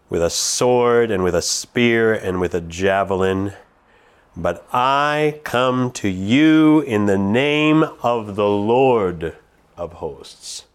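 slow, grave reading of David's words; scripture reading. Acted. Preacher reading David's words to Goliath, slow and grave. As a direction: read it as David's solemn challenge.

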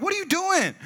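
high, incredulous squeal; incredulous protest. Retold or imitated. Teen to friend turning them in, incredulous squeal. Retells disbelief at friend's betrayal.

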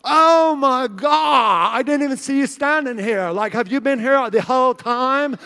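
put-on high, loud, drawn-out party-girl voice; mock a gushing college girl, stretching the words; over-the-top ditzy girl impression. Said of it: "they're even more annoying".

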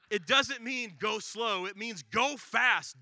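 slow and loud, every word hammered; slow, loud emphasis. Real, not acted. Preacher hammering the word's meaning to the church, slow and loud. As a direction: drive each word home to the congregation.

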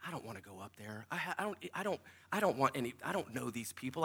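low, hushed thinking to yourself; reluctant inner thought. Retold or imitated. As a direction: retell a nervous thought under the breath.

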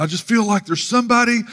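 high, far-carrying preacher's appeal; imitated pastor. Pastor singling out visitors in the seats, imitated altar call. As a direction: do a pastor urging someone in the crowd forward. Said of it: "usually that pastor would pick out".

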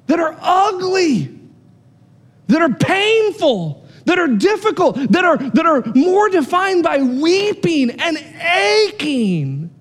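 high, slow, aching sermon cry; impassioned preaching. Acted. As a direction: stretch each word out like a lament from the pulpit. Sermon climax on suffering.

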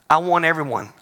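usual speaking voice

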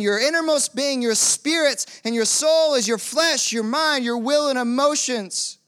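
high, driving preacher's cry; full-force preaching. Acted. Pastor urging the congregation to live by truth, fervent preaching. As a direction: preach it out to the whole room.

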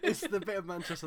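words broken by real laughter; can't stop laughing while explaining; giggling through the joke. Real, not acted. Laughing at a funny place name.

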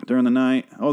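usual speaking voice

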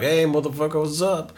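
lightly put-on rapper hello; hip-hopper greeting. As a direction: answer with borrowed hip-hop swagger. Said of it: "doing the whole, uh, whole, uh, hip hoppy thing".

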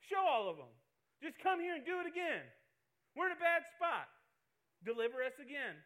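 loud, high pleading prayer; preaching prayer. Acted. Preacher begging God to deliver his people. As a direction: cry out to God at full force.

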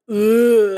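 loud laughing groan of disgust; disgusted ugh. Retold or imitated. Hearing the knife-licking sound, loud disgusted ugh. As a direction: recoil at the gross sound. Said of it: "I literally was like".

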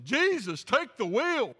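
loud, high, hammed-up plea to heaven; mock desperate cry. Desperate sinner crying out to Jesus, mock loud plea. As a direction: cry it out like a sinner at the end of the rope. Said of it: "in just a sense of desperation".

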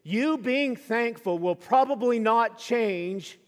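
loud, high, rousing preaching; preaching at full force. Acted. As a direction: lift the congregation to the climax. Climax of the sermon.

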